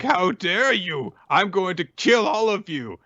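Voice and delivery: hammed-up sci-fi villain voice; mock villain threat. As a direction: play the alien bristling with menace.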